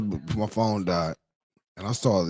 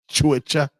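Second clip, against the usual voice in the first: higher mock-British "Twitter", said like "Twitchuh"; British accent; 6 semitones higher, 4 dB louder than usual. Streamer asking how UK says Twitter, mock British accent. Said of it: "is that how you say Twitter in the U K".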